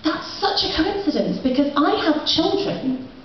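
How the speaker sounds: high, gushing mock parent voice. Mock gushing parent cooing at the poet. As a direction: coo at the poet like an overexcited mum. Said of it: "they're like".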